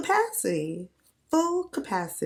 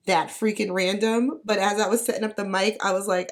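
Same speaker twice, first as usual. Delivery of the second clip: ordinary talk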